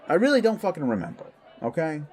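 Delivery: high, rapid, exasperated burst; defensive outburst. Acted. Pressed about his first transformation, defensively exasperated. Snaps when pressed on painful memory.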